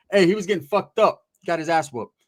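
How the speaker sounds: usual speaking voice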